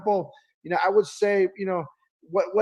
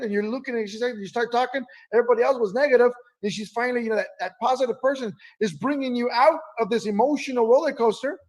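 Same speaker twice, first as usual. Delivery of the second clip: usual speaking voice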